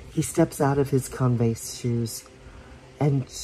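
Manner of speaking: ordinary delivery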